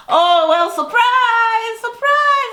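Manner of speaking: very high, loud, drawn-out "surprise"; mock surprise cheer. Recapper imitating ladies caught unready greeting Cynthia, hammed-up high cheer. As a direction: sing out a hammed-up party greeting.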